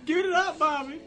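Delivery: high, loud demand; urgent calling. Real, not acted. Player needing help from Bobby, urgent high shout.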